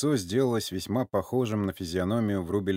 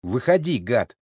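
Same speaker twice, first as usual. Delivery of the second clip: loud, contemptuous order; angry growl; 3 semitones higher, 6 dB louder than usual. A narrator's reading. Sergei orders the old man out of the car, growling. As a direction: throw them out angrily. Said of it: "рычал Сергей".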